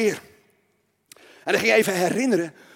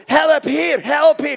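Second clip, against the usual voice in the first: loud, high acted plea; panicked cry for help; 6 semitones higher, 7 dB louder, much slower than usual. Preacher as frightened king crying to God, panicked cry for help. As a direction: call out in mock desperation.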